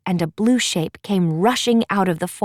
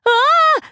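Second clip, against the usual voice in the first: short high, loud shriek of fright; a sudden startled scream; a girl's frightened yelp; 6 dB louder than usual. Acted. Cedar flees woodpecker.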